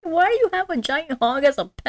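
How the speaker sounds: high-pitched baffled question; mock incredulous. Viewer questioning a giant hog as a pet, mock incredulous. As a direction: ask it high, in comic disbelief.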